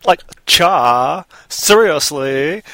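put-on Valley Girl voice. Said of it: "like, Valley Girl style?".

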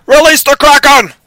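high, full-force movie roar; huge hammy shout, far above talking pitch; bellowed battle cry. Acted. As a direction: bellow it like a god unleashing a monster. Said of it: "I had to get that one out".

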